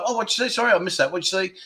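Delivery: soft, unhurried asking to repeat; gentle polite asking. Retold or imitated. Missing what a friend said, soft polite asking. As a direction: ask softly, like you missed a word. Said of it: "not, like, aggressive".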